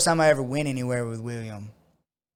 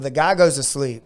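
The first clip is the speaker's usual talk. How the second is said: ordinary talk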